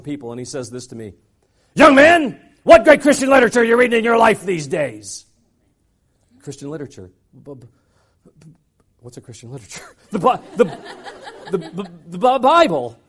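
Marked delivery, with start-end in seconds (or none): 1.7-5.3 booming question. Retold or imitated. Speaker quizzes him on stage. 6.4-13.0 flustered stammer. Retold or imitated. Stammering on stage.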